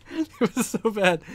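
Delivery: high, slow words through real giggles; cracking up at the memory; laughing. Real, not acted. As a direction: laugh helplessly while talking. Cracks up recalling the disgusting bathroom.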